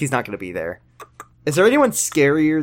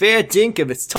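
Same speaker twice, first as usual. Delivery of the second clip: put-on Australian accent; 6 semitones higher than usual. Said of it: "us doing Australian voice".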